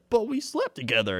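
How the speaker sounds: high-pitched imitated objection; mock protest. Retold woman protesting his denial.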